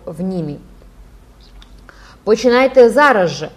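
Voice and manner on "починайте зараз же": loud, higher-pitched yell; yelling schoolboy. A narrator's reading. A schoolboy demands that they begin right now, yelling. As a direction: yell the order over a crowd. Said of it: "верещав школяр".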